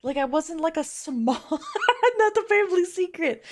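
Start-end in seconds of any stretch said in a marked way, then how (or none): 1.4-3.5 high squeaky giggle through words; laughing. Real, not acted. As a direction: laugh it out mid-sentence. Laughs at her own slip.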